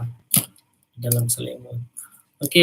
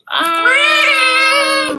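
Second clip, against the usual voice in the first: pained giant cry; 9 dB louder than usual. Acted. Giant's eye poked.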